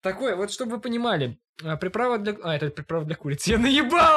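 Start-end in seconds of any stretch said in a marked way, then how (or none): 3.5-4.2 loud, high, laughing reveal; gleeful shout. Real, not acted. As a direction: crow about pulling a prank. Gleeful reveal of his prank.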